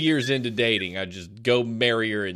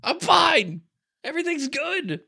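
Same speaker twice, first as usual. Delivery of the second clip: high, louder denial of yelling; mock insistence; 3 dB louder than usual. Denying yelling to wife, a high strained mock insist. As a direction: insist you're fine, pitched way up. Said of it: "I'm like".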